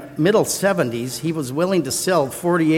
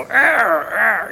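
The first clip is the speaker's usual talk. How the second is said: gravelly growl; 6 semitones higher than usual. Retold or imitated. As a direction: growl it like a rough old neighbor. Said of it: "he had this gravelly voice".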